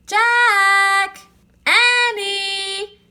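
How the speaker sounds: high, loud sung-out call from far away; distant call. A narrator's reading. Mom calling her children from far off, sing-song. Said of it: "came the distant cry again".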